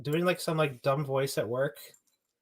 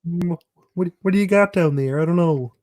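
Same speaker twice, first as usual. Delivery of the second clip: Kermit voice; 5 dB louder than usual. Pretend. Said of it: "Pennywise luring Kermit".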